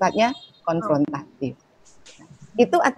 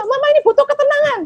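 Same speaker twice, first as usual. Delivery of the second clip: angry shout; 6 dB louder, faster than usual. Shown as a demonstration. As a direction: blow up and yell it fast. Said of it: "ini kan jadi you statement".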